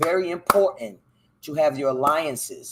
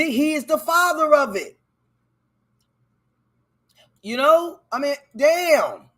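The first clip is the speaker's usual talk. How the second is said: high, loud and urgent; worked-up rant; 11 semitones higher, 4 dB louder than usual. Real, not acted. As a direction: plead with the audience, pitched way up. Ranting at viewers about propaganda.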